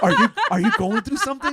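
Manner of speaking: high, loud, laughing concerned voice; mock the worried friend through laughter. Teasing his partner's worried question, high and laughing.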